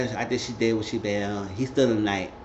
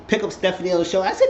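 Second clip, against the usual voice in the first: normal talking voice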